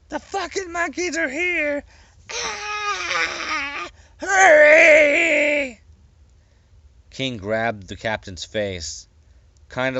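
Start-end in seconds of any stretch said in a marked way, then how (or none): 0.1-5.8 very high, shrieking cry for help; screaming in pain. A narrator's reading. Captain with a broken wrist screaming into the speaker. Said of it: "screamed in pain and yelled".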